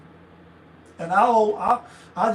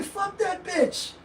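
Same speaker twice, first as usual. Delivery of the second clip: casual dismissal; 8 semitones higher, 4 dB quieter, much slower than usual. Retold or imitated. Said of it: "you don't mean it with no vitriol".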